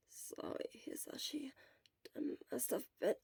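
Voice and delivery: weak sick whisper; breathy apology from a sickbed; hoarse whisper. Acted. Sick Shura whispering weakly.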